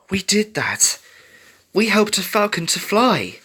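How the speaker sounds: high, hushed wonder; awed, airy disbelief; amazed. A narrator's reading. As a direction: marvel at it, pitched well up. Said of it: "Scarlet said amazed".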